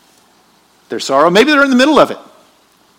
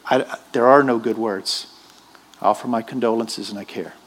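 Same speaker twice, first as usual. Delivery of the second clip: soft condolence; 6 semitones lower, 5 dB quieter than usual. Retold or imitated. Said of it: "You just say".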